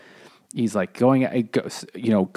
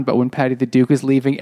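usual speaking voice

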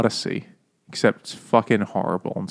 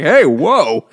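loud, higher-pitched startled protest; mock startled; 5 semitones higher, 8 dB louder than usual. Character reacting to hot nuts, mock startled shout. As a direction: cry out as if caught off guard.

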